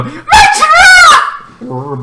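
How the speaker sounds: high, loud, laughing mock cheer; hammed-up delighted yell; overjoyed hero. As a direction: shout with joy at finding his truck. Hero overjoyed to see his truck.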